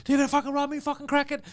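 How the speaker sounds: high-pitched angry complaint; acted-out outrage; retold going crazy. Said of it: "I start crazy".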